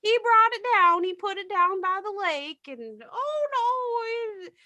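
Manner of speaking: high-pitched fretful old lady impression; confused patient. Retold confused patient rambling.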